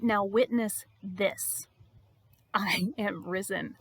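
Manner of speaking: very slow, weighty declaration; solemn proclamation. Acted. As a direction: stretch each word for a momentous reveal. Jesus announces his resurrection.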